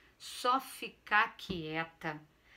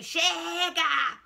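sudden much higher, louder outburst; furious shout; 8 semitones higher, 7 dB louder than usual. Acted. Exhausted mother snaps at son, furious shout. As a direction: explode at him all at once. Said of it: "dei um ataque e gritei".

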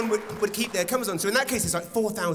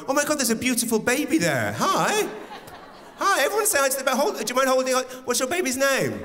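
put-on high, loud gushing over a baby; comic fussing over the baby, high and loud; gushing host; 9 semitones higher, 4 dB louder than usual. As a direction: coo over the baby to stall a dying gig. Imitating host distracting with baby.

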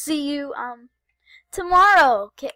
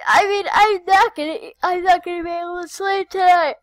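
excited; 6 semitones higher, 12 dB louder than usual. Real, not acted. Too excited to sleep.

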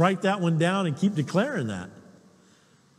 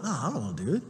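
reluctant refusal; 6 semitones lower, 7 dB quieter, much faster than usual. Retold or imitated. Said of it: "I'm like".

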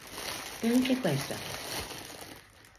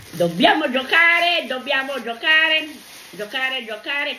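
excited sing-song; 5 semitones higher, 5 dB louder than usual. Real, not acted. Grandma repeats, excited about gift.

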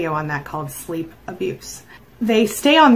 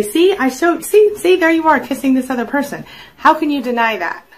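high, louder acted accusation; insistent confrontation; 6 semitones higher, 5 dB louder than usual. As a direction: confront them with the photo as proof. Acting out confronting a cheater with proof.

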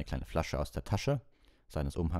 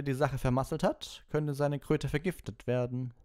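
mocking Snape; 10 semitones higher than usual. Snape mocking Neville before the class, sneering. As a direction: relish Neville's expected failure.

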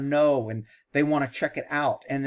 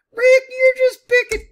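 high, loud mocking whine; mock critic; 11 dB louder than usual. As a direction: talk back at Rick as a shrill fan. Imagined critic scolding Rick.

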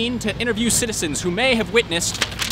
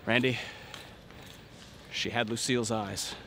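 wistful murmur; 9 semitones lower, 8 dB quieter than usual. Acted. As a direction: breathe it out, lost in memory. Lovestruck confession.

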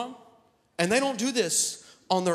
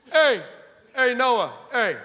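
loud, higher hail to someone; sharp, raised-voice "hey"; calling out; 5 semitones higher, 6 dB louder, slower than usual. Acted. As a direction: call for attention across a distance. God calling out to Noah.

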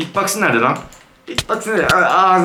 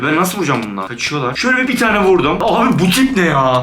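usual speaking voice

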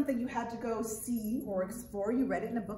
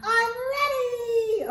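high, loud excited puppet voice, partly sung; 10 semitones higher, 6 dB louder, much slower than usual. Acted. Puppet Arturo greeting the children, high excited voice. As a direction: bounce in as an eager little monkey puppet.

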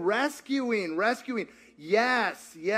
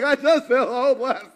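imitating a hopeless sigh through laughter, higher and louder; laughing mock despair; 6 semitones higher, 8 dB louder than usual. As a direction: laugh while faking his hopeless line. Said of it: "Scott was like".

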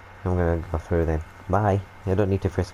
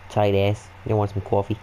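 normal talking voice

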